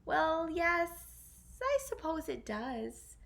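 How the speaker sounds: high, drawn-out sly reply; sly smile. Acted. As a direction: smile slyly and drag it out. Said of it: "with a sly smile".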